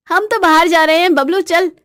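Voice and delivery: brash, commanding wife; bossy wife. Acted. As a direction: announce it and order him along. Wife orders husband out.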